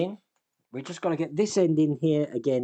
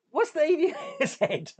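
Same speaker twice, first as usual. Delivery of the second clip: much higher, cracking up mid-sentence; helpless high-pitched laughter breaking the words; laughing; 12 semitones higher than usual. Real, not acted. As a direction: read it out through giggles. Cracks up reading out a viewer's comment.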